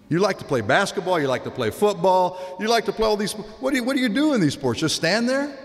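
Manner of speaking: high, incredulous mock scolding; imitated coach's scolding. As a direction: rattle off challenges, pitch climbing. Coach scolding a reluctant child runner.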